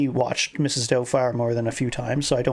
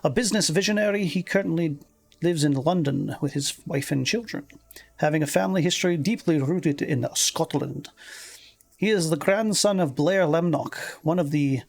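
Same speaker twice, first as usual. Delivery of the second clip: lilting, higher-pitched Scottish reading; Scottish accent; 3 semitones higher than usual. Acted. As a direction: read the article as a Scottish woman. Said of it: "I've been doing the Scottish accent".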